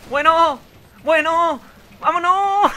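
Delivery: high, loud excited yells; panicked yelling. Real, not acted. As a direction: yell in rising panic. Panic as enemy attacks.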